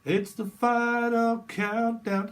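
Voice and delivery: high, slow, drawn-out final countdown; mock countdown announcer. Psychic hamming up a rocket launch countdown.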